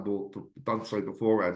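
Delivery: ordinary delivery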